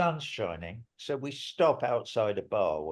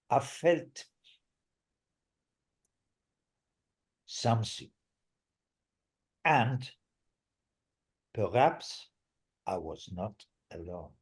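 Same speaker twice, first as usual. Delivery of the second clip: put-on French accent. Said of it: "my quasi-French accent".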